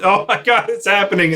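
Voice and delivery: high-pitched echo of a freak-out; mock panic. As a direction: imitate a fleeing air crew, without volume. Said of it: "that's what the air crew said".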